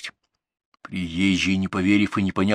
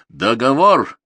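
Договор hit hard, higher and louder; weighty repetition; 4 semitones higher, 6 dB louder, slower than usual. A narrator's reading. Rider to officials as he leaves, Договор said weightily and loud. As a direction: land Договор firmly as the final word. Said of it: "весомо повторил он".

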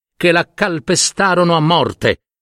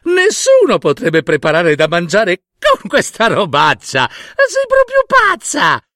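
high, sneering scorn; girl scoffing at the old woman over supper; scornful mockery; 5 semitones higher than usual. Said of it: "la ragazza scoppiò a ridere ancora".

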